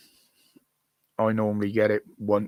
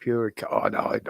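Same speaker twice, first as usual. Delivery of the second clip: ordinary talk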